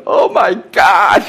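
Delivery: stunned secretary, slow excited cry; freaking out. Retold or imitated. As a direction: gasp it out like she can't believe it. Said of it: "My secretary was freaking out".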